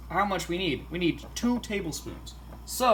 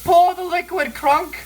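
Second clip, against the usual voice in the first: excited shout; 10 semitones higher, 9 dB louder than usual. Real, not acted. Excited shout while cooking.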